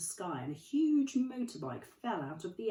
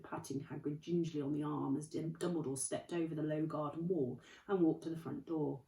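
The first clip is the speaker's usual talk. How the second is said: plain reading voice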